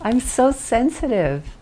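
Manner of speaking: mock dramatic. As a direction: overplay the sensitivity for laughs. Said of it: "It's actually kind of funny".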